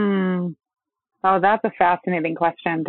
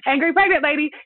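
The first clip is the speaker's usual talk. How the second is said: mock innocence; 8 semitones higher than usual.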